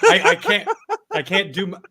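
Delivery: breaking up with laughter, voice jumping high; giggling so hard the words won't come; laughing helplessly. Real, not acted. Cracking up at the Gottfried joke, laughing through the words.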